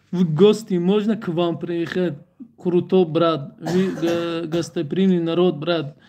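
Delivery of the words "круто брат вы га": ordinary talk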